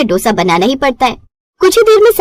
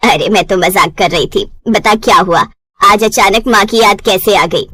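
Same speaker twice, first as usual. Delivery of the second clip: lower, knowing mother's warning; mother warns daughter she'll come running back, lower voice; 5 semitones lower than usual. Acted. Mother on phone.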